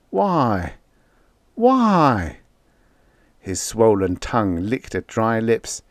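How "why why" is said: a feverish man begging to understand; desperate why. A narrator's reading. Feverish pilot crying out at the rising needle, anguished.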